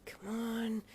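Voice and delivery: whispered impatient hiss, slow. Acted. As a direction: hiss it under your breath. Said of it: "you hissed".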